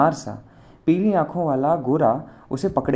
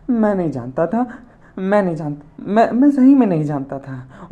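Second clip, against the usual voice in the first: tearful; 7 semitones higher, 4 dB louder than usual. A narrator's reading. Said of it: "उसकी शक्ल और भी रोनी हो गई".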